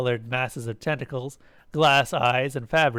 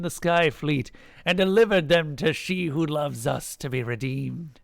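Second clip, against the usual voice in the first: solemn proclaiming chant; priest intoning; 4 semitones higher than usual. A narrator's reading. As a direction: intone it to a congregation, easing down from a high start. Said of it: "the priest intoned".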